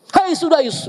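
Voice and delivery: mock scolding call, high then falling; angry mother. Sudais's mother seeing spilled food, mock angry shout. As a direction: call out sharply at the naughty child.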